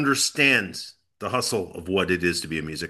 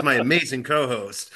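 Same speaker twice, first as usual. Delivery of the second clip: ordinary talk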